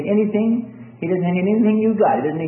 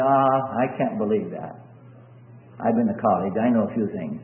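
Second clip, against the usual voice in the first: low, soft, unconvinced reply; dismissive skeptic; 8 semitones lower, 5 dB quieter than usual. Acted. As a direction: brush it off as a know-it-all doubter. Doubting prisoner answers the yelling.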